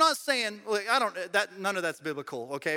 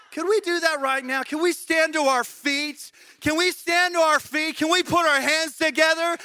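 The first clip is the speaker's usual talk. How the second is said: loud, high rallying call to stand and worship; preaching at full force; 7 semitones higher, 5 dB louder than usual. Acted. Pastor urging the church to stand, loud rallying cry. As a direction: whip the room up into praise.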